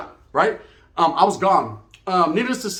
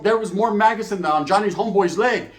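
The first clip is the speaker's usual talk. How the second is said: ordinary delivery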